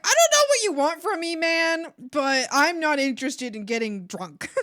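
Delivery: loud, high fed-up retort; exasperated outburst. Retold or imitated. Daughter snapping back at pushy dad, exasperated yell. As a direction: throw your hands up at him.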